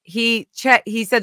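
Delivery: usual speaking voice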